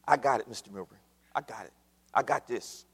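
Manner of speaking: low, soft, casual reassurance; a lightly voiced driver brushing it off; navigator reassuring. Driver calming his worried boss, low and soft. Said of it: "navigator answers".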